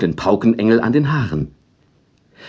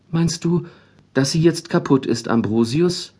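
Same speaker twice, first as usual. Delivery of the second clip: a lightly higher small angel voice; timid angel question; 8 semitones higher than usual. A narrator's reading. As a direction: ask worried, lightly voiced. Angels ask after the accident.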